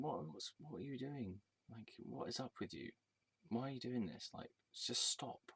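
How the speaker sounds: quiet, exasperated plea; murmured plea to dad to just stop; hushed pleading. Retold or imitated.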